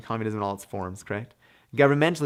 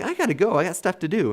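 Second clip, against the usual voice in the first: higher, hurried, mock impatience; impatient citizen; 7 semitones higher than usual. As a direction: brush it off like you need to leave. Voicing impatient citizens at assembly.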